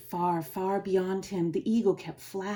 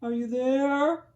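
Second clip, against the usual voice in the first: slow, louder searching call; 4 semitones higher, 5 dB louder, slower than usual. Acted. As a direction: call into the clovers. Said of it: "and he called".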